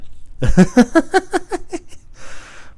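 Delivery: very high, rapid stuttering giggle; real squeaky laughing fit; long high-pitched cackle; odd laugh. Real, not acted. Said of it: "why do I laugh like that?".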